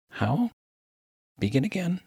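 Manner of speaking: high, puzzled minister's question. Acted. Minister lost in the miner's slang, high and puzzled. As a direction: ask it high, politely lost.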